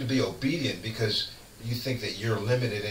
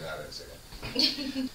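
squeaky, slow mock voice, pitched way up; mock high denial; 4 dB quieter, slower than usual. Mocking a man who denies it, high and slow. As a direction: play it like a high, slow falsetto protest.

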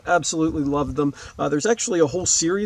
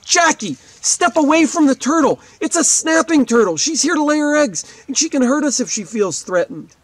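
higher, louder warning shout, read aloud; acted yell; 10 semitones higher, 6 dB louder than usual. Narrator warning Jackie away from snapping turtle, acted yell. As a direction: warn her off urgently, but as storytelling. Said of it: "stood up and yelled out".

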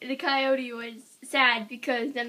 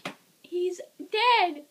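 mock alarm; 8 semitones higher, slower than usual. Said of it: "so I'm like".

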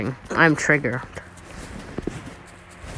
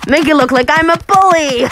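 gleeful; 6 semitones higher, faster than usual. Real, not acted. Gleeful mischief.

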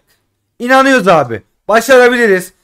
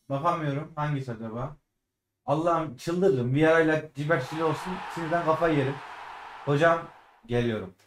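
hushed, much softer, tense near-whisper; too nervous to look; 5 semitones lower, 14 dB quieter than usual. Real, not acted. Gamer to viewers during a pack reveal, hushed nervous murmur. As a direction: hold your breath and murmur, afraid to watch.

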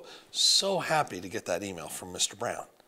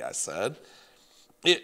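usual speaking voice

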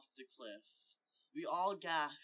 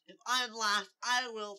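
high, loud threat, cut short; raised, worked-up protest that breaks off; cut-off outburst; 8 semitones higher, 14 dB louder than usual. Acted. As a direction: shout it until interrupted. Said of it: "Fry U I was interrupted".

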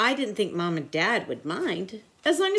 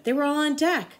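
ordinary delivery

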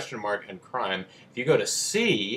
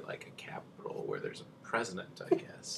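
soft unvoiced whisper; 3 semitones lower, 12 dB quieter than usual. Real, not acted. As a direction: breathe it as a whisper. Unvoiced aside.

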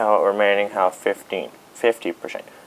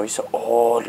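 ordinary talk

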